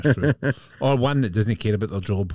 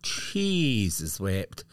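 dragged-out, very slow; slow mock exclamation; much slower than usual. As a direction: stretch it out slowly. Mock mechanic's reaction.

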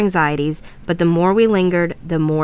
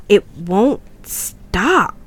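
very slow, stretched-out frightened voice; 3 semitones higher, much slower than usual. Acted. Daniel with the haunted speaker, frightened beyond belief. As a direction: draw each word out in dread. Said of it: "Daniel looked frightened beyond belief".

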